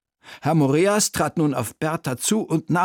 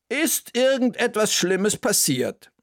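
worried question; 8 semitones higher than usual. Acted. Bertha asks worried.

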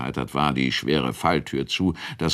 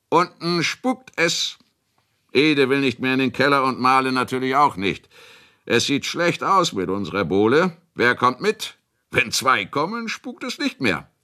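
high, mock-serious public announcement; a touch of jovial bravado, pitched up; comic announcing; 8 semitones higher, 3 dB louder than usual. A narrator's reading. Host making a joke of the servants' refusal, comic announcing. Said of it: "ins Komische zu ziehen".

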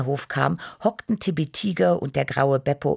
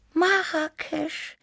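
very high, slow dreamy cat voice; high dreamy kitten; much slower than usual. A narrator's reading. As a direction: draw the name out wistfully, high and slow. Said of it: "wiederholte Tibi verträumt".

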